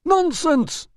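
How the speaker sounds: loud, very high dismissal; sharp retort. Acted. Manager dismisses the doorman's charge, loud and high. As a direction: fling it back at him. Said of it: "retorted Priscus".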